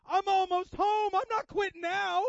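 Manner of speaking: high, loud fervent declaration from a dying believer; fired-up defiance. Retold or imitated. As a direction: ring it out like a dying woman who won't quit. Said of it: "She goes".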